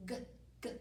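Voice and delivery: short repeated G, g; isolated g sound. Shown as a demonstration. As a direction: tap out the g sound twice. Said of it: "The G sound".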